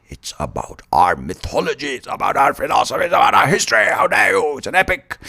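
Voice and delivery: building indignant put-on voice; mock outrage. Offended defender of the epic, mounting mock outrage.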